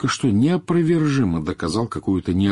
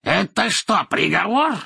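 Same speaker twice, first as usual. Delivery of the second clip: high, spiteful question; angrily demanding an answer, pitched way up; angry raised voice; 12 semitones higher, 4 dB louder than usual. A narrator's reading. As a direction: raise your voice in malice. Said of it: "злобно повысив голос".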